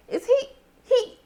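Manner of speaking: high, halting double-take; mock disbelief. Viewer watching him pee on the floor, mock disbelief. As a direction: stammer it high, as if stunned. Stunned reaction to floor scene.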